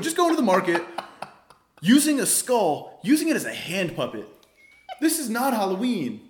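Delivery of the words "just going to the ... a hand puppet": high, loud laughing disbelief; amused disbelief. Real, not acted. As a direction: squeal it out in disbelief. Laughing disbelief at skull story.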